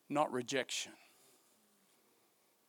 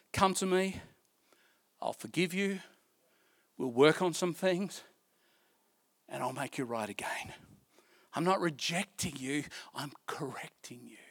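hushed tender reassurance; tender divine voice; 2 semitones lower, 4 dB quieter than usual. Acted. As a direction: speak it like a loving whisper to a child.

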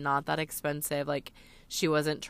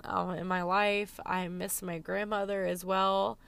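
ordinary delivery

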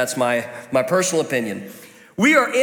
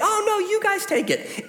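much higher, sugary mock-polite offer; fake politeness; 13 semitones higher than usual. Guest politely offering the last piece to friends, higher fake sweetness. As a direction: sweetly pretend you don't want the last piece. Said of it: "you're saying".

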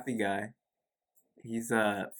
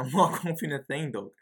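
normal talking voice

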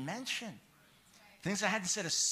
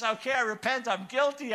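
mock confession; 7 semitones higher, 7 dB louder than usual.

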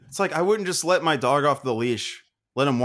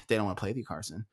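softer, lower mock-mom aside; muted mom quote; 5 semitones lower, 10 dB quieter, much faster than usual. Retelling mom's remark to Carson, quiet weary imitation. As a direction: toss off the quote under a sigh.

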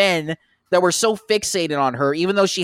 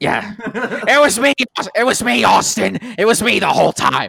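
gloating villain reveal; 3 semitones higher, 4 dB louder than usual. Retold or imitated. Vince villain reveal reenacted.

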